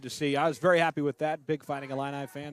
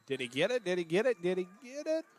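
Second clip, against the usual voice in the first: rising suspense; 5 semitones higher than usual. Real, not acted. Unsure if first down made.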